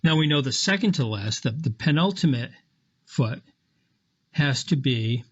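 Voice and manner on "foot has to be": normal talking voice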